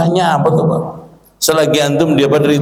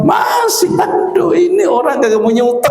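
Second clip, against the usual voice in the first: mocking onlooker. Onlookers mocking the camel riders.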